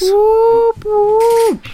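high, loud celebratory whoop; excited whoop. Real, not acted. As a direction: cheer it like a small win. Celebrating getting work.

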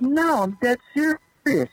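clubhouse manager, deadpan. Retold or imitated. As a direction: insist slowly, pitched well up. Retold locker room exchange.

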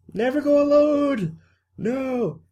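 drawn-out, high-pitched cry; acted high wail. Podcaster mimicking a film character's warning, high drawn-out wail.

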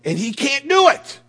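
high, loud burst of frustration; exasperated shout. Real, not acted. Preacher on a man wanting his way, exasperated shout. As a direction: blurt it out at full voice.

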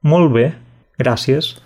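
"Molt bé" in Catalan, then "gracias" as gràcies; Catalan phrase. Shown as a demonstration. Teacher models "Molt bé, gracias" in Catalan. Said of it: "Sería algo así como".

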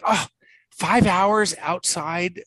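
dreading a day of golf, drawn out; mock dismay. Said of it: "I'm like".

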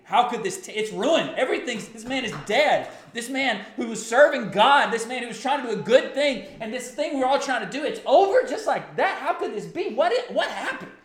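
high, loud, anguished outcry; anguished anger. Retold or imitated. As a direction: cry out at God in bewildered grief. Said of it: "You're just angry".

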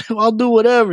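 high-pitched, pleading concession; retold giving in. Grabbed teenager bargains with the man owed money, yielding. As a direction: act out a cornered boy yielding. Cornered boy gives in.

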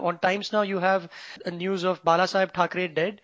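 ordinary talk

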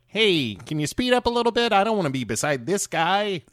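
high, fast mocking whine; mocking high Tom Cruise. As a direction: squeak it out like a whiny Tom Cruise. Tom Cruise voiced as orderly.

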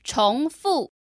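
重复 said slowly, rising then falling tone; practicing tones. Shown as a demonstration. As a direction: clear second tone on 重, fourth tone on 复. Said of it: "second tone and a fourth tone".

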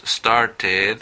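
"started" slowly split as star-ted; separate "ed" syllable. Shown as a demonstration. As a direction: give "started" a full, distinct final "ed". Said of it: "que es ed".

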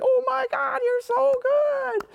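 very high, slow, gushing praise voice; excited praise to dog. Retold or imitated. Trainer praising his dog after a perfect water retrieve, very high excited voice. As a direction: sing the praise up high like to a puppy. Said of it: "when I change the tone".

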